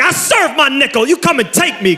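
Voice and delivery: high, cocky jailbird bravado; mock ex-con confidence. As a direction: act like jail doesn't scare you. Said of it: "With the confidence of guys who have".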